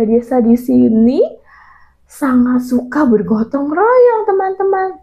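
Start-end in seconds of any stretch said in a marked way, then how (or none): none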